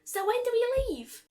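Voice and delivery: impatient, excited pony voice; eager question. A narrator's reading. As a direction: ask it like you can't wait to go. Eager pony asks to leave.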